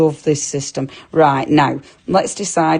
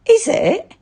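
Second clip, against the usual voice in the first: ordinary talk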